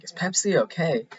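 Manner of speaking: imitating Carell's puzzled falling question; Steve Carell. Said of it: "and he's like".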